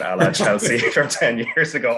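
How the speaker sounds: chuckling through the punchline; laughing. Real, not acted. Pundit laughing at his own joke about United, laughing. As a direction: laugh as you land the joke.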